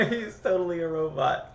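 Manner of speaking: laughing through the words; giggling while saying it. Real, not acted.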